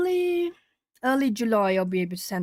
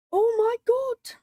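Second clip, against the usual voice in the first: high, slow retold amazement; squeaky-high reenacted astonishment; astonished; 13 semitones higher, 4 dB louder, slower than usual. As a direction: stretch it out in high-pitched wonder. Acts out astonishment at subscriber count.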